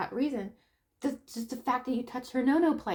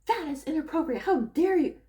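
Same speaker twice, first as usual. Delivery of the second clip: higher, louder pretend scolding; mock indignant; 5 semitones higher, 5 dB louder than usual. Confronting a teen's father, mock indignant scolding. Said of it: "if I were to go up".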